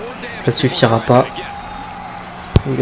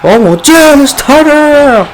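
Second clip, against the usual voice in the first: excited; 14 semitones higher, 6 dB louder, slower than usual. Real, not acted. Commentator reacts to stunner.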